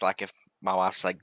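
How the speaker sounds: ordinary delivery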